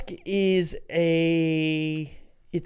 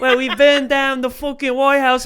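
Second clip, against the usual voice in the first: imitating English heckler; 9 semitones higher, 7 dB louder than usual. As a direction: lightly play a smug English heckler. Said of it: "And then he was like".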